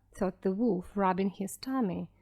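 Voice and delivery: plain reading voice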